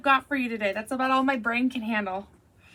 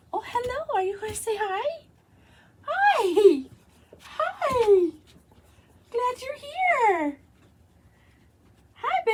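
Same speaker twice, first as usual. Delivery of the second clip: much higher sing-song pet voice, drawn out; 11 semitones higher, slower than usual. Real, not acted. Cooing at the dog Daisy in a high baby voice.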